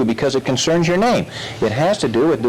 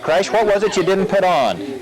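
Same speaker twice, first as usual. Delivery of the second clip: ordinary delivery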